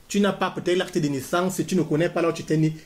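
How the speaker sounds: ordinary talk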